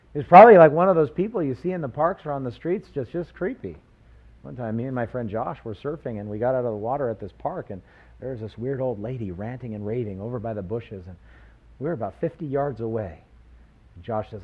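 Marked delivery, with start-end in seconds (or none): none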